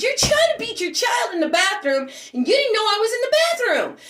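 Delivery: high-pitched accusing yell, not full volume; retold yelling. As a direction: re-enact yelling an accusation at her. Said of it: "and I'm yelling at her".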